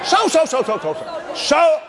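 rapid-fire chant, starting high; insistent repeating. Retold or imitated. Preacher showing he said it all day, insistent repeating. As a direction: fire the word off over and over.

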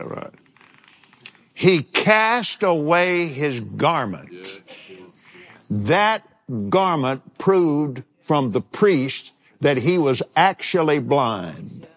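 ordinary delivery

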